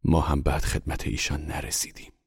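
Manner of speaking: usual speaking voice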